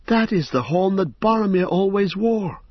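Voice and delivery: put-on startled young hobbit exclaiming; hobbit crying out. A narrator's reading. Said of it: "cried Pippin".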